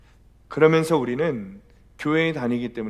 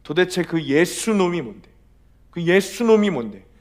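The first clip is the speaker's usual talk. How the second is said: raised, contemptuous guard's demand; higher, forceful retold outburst; shouted curse; 4 semitones higher, 5 dB louder than usual. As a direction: curse him out like an angry prison guard. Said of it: "막 소리를 지르면서 예수님을 욕하는".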